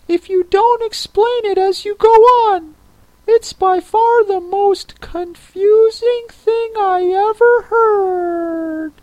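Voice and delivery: very high creature voice cutting in; interrupting Mock Turtle. A narrator's reading. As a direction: break in with a high, fussy turtle voice. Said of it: "the Mock Turtle interrupted".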